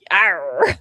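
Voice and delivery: growled pirate arr. Pretend. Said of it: "sound like a fucking pirate".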